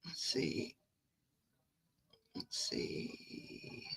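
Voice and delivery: whispered under her breath, scanning the chat; muttered to herself. Real, not acted. Streamer whispering to herself while scrolling through chat. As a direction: mutter it privately, barely voiced.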